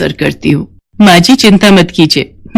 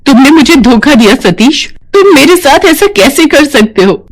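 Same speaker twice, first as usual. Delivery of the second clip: high-pitched, wounded protest; betrayed outrage; 7 semitones higher than usual. Acted. Pregnant nurse confronting her betrayer, outraged. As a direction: accuse him, pitch climbing.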